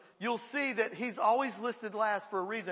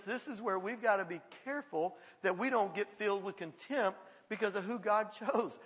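ordinary talk, nothing marked

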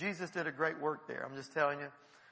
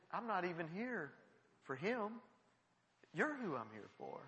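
soft, hushed assurance; gently sincere; 2 semitones lower, 10 dB quieter than usual. Acted. Preacher to the struggling man, gently sincere. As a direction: drop to a quiet, personal tone. Tells the man he came for him.

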